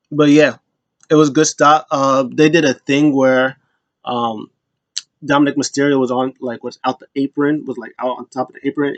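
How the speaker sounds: ordinary talk, nothing marked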